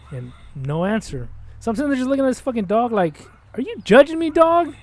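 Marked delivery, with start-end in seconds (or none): 3.5-4.7 high, loud, incredulous challenge to a dog; mock-outraged accusation; indignant challenge. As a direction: demand an answer, pitched way up. Acted confronting of the staring dog.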